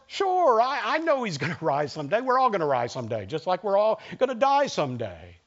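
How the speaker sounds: brushing it off with a laugh, high then quick; airy mock-casual dismissal; dismissive. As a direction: shrug it away as obvious, laughing. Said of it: "In other words".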